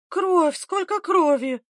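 high, drawn-out frightened cry; weak cry. A narrator's reading. Woman in labour seeing blood, weak cry. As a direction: cry out weakly in alarm. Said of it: "слабо вскрикнула Варвара".

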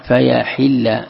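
"فيحل" slowly shown as fa-yaḥilla; kasra on ḥā’. Shown as a demonstration. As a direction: put an i after the ح in "فيحل". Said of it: "بكسر الحاء". ("فيحل" بكسر الحاء)

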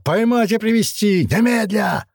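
high, furious shouted orders; raging. A narrator's reading. Governor ordering the fugitives caught, raging. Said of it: "Наместник бушевал, рвал и металл".

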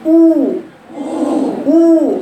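«أو» أعلى وأقوى من الجوف; أشرك الجوف بقوة في «أو». Shown as a demonstration. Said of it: "هاتيلي الجوف معاكي". («أو» رنانة ممتلئة بضم الشفتين)

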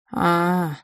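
trailing, stretched realisation; drawn out. A narrator's reading. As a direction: stretch the sound out, then fall silent. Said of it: "протянул он и замолчал".

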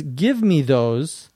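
"Give me those." drawn out slowly; "Give me those" read slowly and clearly. Shown as a demonstration. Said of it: "this time at a normal speed".

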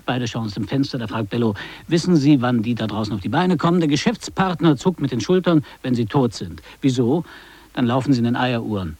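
measured on-air commentator voice, rising on each rhetorical question; a radio host making his case to listeners; radio commentary. Acted. Host on air to his listeners, measured broadcast commentary.

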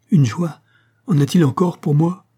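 loud, high, incredulous outburst; anguished question. A narrator's reading. Lucien's bitter reply about joy.

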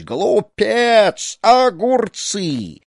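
high, loud, long-drawn yells; shouting after someone. A narrator's reading. Snake charmer yelling after the fleeing boy, angry shout. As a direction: hurl each word after a runaway. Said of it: "а вслед ему неслось".

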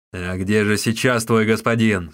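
loud, commanding question; forceful questioning at a normal pitch; stern interrogation. A narrator's reading. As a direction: demand an answer from your captive. Victor interrogates captive.

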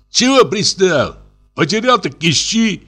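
annoyed, high-voiced defiance; irritated retort. A narrator's reading. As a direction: brush off the accuser in a high, whining voice. Thief snaps back in quarrel.

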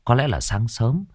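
plain reading voice

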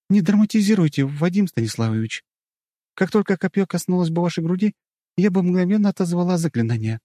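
put-on light, playful young woman; teasing girl voice. A narrator's reading. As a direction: tease him, brushing it off. Myshkina's reply in character. (мышкина подшучивает над вадимом)

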